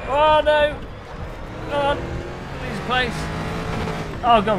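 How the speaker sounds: sudden loud high "oh no"; dismayed cry. Real, not acted. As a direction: groan it out as the race slips away. Race going badly.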